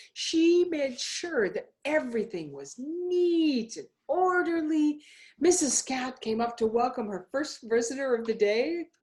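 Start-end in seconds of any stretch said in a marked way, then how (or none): none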